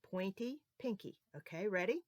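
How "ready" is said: ordinary talk, nothing marked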